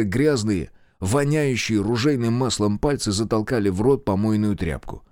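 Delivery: ordinary delivery, nothing marked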